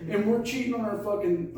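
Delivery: ordinary talk, nothing marked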